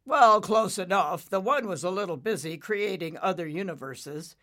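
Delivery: lightly voiced hearty dad; father cloud. Father explaining to stunned Nimbus, hearty. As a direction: open loud, then settle into explaining.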